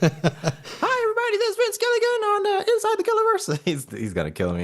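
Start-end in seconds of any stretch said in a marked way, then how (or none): 0.0-3.6 very high squeaky Southern cartoon voice, laughing; giggling high-pitched Mickey Mouse impression; Vince Gilligan impression. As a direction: ham up a squeaky mouse host intro. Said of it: "When you do the Vince Gilligan impersonation".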